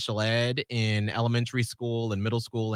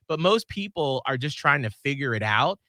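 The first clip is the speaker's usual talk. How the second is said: usual speaking voice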